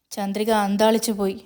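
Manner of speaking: usual speaking voice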